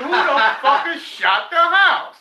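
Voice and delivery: high, loud, slow, laughing through it; laughing disbelief. Retold or imitated. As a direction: crack up while asking who that is. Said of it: "I said".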